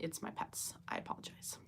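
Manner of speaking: hushed whisper, barely voiced. Real, not acted. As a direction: whisper so the pets stay asleep. Pets asleep in the background.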